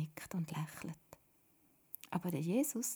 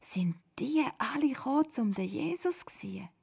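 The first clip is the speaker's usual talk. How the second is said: higher-pitched wondering question; astonished; 6 semitones higher than usual. A narrator's reading. Miriam amazed at the crowd, wondering. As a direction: ask in amazement. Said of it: "staunet Miriam".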